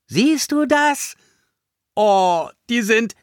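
high, loud gushing over something cute; thrilled cooing; delighted amazement. A narrator's reading. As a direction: squeal with wonder, slowly savoring it. Boy delighted by fluffy bunnies.

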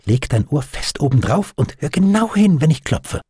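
high, eager instruction; smiling. A narrator's reading. Doctor shows his friend the humming parcel, smiling eagerness. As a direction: share a treasure with a smile. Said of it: "sagte er lächelnd".

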